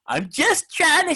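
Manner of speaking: high, put-on voice mocking a player; mocking Frazier's voice. Host mocks player's quote to listeners, in a high mocking voice. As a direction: squeak it out like a meek ballplayer. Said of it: "that's how he talks".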